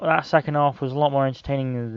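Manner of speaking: ordinary talk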